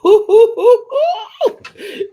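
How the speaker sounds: big shrieking laugh bursting out; loud laugh. Real, not acted.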